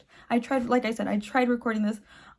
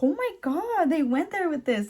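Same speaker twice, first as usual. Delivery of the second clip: amazed; 5 semitones higher than usual. Retold or imitated. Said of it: "I was like".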